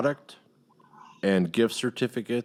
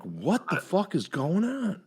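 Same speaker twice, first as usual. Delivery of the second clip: baffled disbelief; 8 semitones higher, 4 dB quieter, slower than usual. Retold or imitated. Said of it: "And I'm like".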